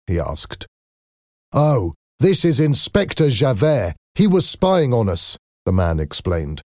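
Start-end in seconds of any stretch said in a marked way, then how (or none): 1.5-5.4 explaining. A narrator's reading. Young man explains the captive.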